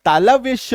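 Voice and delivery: teacher stresses तालव्य श with a clear sh; palatal sh sound. Shown as a demonstration. Teacher drilling the sound for students, loud and clear. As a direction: say श as a firm palatal sh. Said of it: "इसका उच्चारण क्या होता है?".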